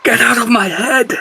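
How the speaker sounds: high-pitched, agitated plea; acted distress. Character begging the voice in his head to go, acted distress. Character fights the voice inside him.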